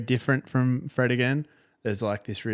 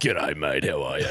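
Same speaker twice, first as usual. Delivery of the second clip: much lower, hammed-up ocker greeting; deep Aussie bloke voice; 8 semitones lower than usual. Greets fake radio guest in voice.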